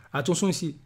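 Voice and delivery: ordinary talk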